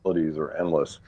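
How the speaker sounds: usual speaking voice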